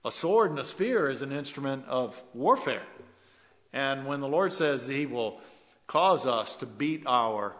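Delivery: measured preacher's cadence, building; preaching. Acted. Preacher explaining the verse to his church, preaching. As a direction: preach it to the congregation.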